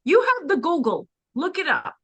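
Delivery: high, emphatic mock scolding from mother; exasperated mother. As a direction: play a mother cutting the debate short, pitched high. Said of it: "and she goes".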